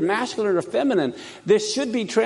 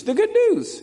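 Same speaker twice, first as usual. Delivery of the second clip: exclaimed; 12 semitones higher than usual. Real, not acted. Preacher exclaiming about good news.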